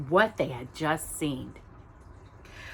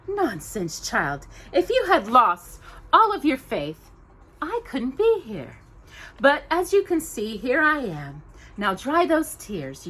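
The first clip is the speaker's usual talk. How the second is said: higher, brisk, motherly voice; kindly fairy godmother; 6 semitones higher than usual. Acted. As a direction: chide her kindly into hope.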